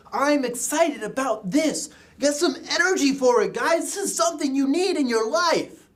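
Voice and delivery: excited, high-pitched pep talk; rally the guys with high, driving energy; Brother Shetler. Retold or imitated. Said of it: "and he shows".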